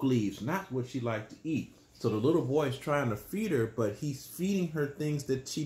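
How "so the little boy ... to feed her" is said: plain reading voice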